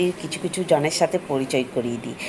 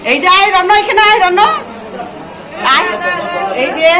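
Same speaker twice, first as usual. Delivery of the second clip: loud call across the room; 10 dB louder than usual. Real, not acted. Calling the boy over.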